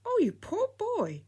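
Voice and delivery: put-on old lady pities Freddy in a high frail voice; pitying old lady. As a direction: coo over the poor boy. Old lady pities the former cabin boy.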